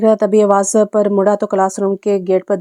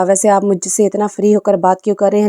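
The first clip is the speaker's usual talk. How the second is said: usual speaking voice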